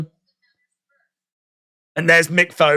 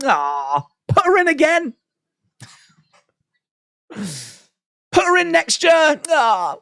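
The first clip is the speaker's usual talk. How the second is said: much higher, slow, drawn-out "put her in again" with stress on "her"; gushing doting plea; 11 semitones higher, slower than usual. Retold or imitated. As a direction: swoon it out high and stretched like a smitten fan. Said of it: "And you're like".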